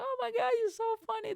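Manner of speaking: high, breathless gushing fan voice. Retold or imitated. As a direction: squeal it like an adoring fan. Couples gushing after a gig.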